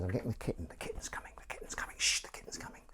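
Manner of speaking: hushed, excited-secret whisper. Real, not acted. Host announcing the kitten's coming, hushed whisper. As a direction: whisper it, with a shush.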